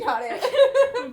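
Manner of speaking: laughing it off with a high burst. Real, not acted. Friend laughs off the mullet, laughing. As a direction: blurt it out through a laugh.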